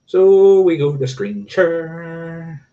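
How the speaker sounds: high, drawn-out sing-song; playful sing-song. Pretend. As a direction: stretch the words out high and playfully. Playful filler while sharing screen.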